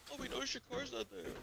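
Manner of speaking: high, soft imitation of a parent; lilting high-pitched parent impression; mock parent voice. Quotes his worried parents on the phone.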